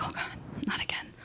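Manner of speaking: unvoiced whisper of dread. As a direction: whisper it in despair, under your breath. Dog runs out again.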